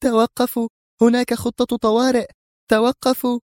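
high, urgent shouted call to stop; Sonia calling out. Acted. Sonia calls after her panicking men to stop, high and urgent. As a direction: cry out to fleeing men to halt. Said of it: "وسونيا تهتف بهم".

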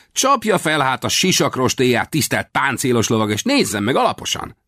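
put-on high boy's voice teasing a knight; boy playfully challenging the ragged rider. A narrator's reading. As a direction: tease him like a cheeky young squire.

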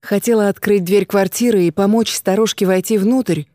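ordinary delivery

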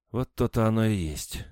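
sorrowful. A narrator's reading. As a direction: agree with a sad sigh in the words. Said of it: "горестно сказала женщина". (горестно)

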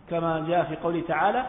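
ordinary delivery

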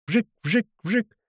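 series of high, quick zips; rocket whoosh imitations. A narrator's reading. Narrator voices a grenade volley, high zips. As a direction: mimic shots zipping off one by one.

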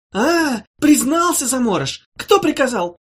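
high, loud interrogating witch; triumphant witch. Acted. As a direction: pounce on the confession.

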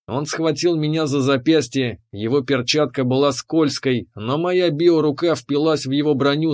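plain reading voice

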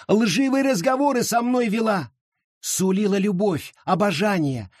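high, frenzied accusation; hysterical outcry. A narrator's reading. Charity cries out to the crowd, high and frenzied. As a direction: rave as if freed from a spell. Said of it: "во весь голос кричала Черити".

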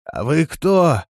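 a hint of a hoarse stranger calling out; lightly hoarse voice. A narrator's reading. Hidden villager calling through the door, lightly hoarse. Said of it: "раздался хриплый голос".

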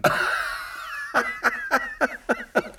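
a high, unvoiced burst of laughter; breathless high laugh. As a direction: wheeze out a laugh at the idea. Kommissar lacht über Priester. (hohes, tonloses Auflachen)

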